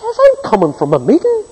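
a driver sure his Bible clears him; smug excuse. Retold or imitated.

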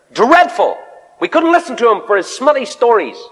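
put-on high, slow voice of the girl on the plane; American woman's voice. Preacher voices the girl telling her school story, in a lightly high voice. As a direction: lift into her voice as she tells it. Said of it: "in her nice American drawl".

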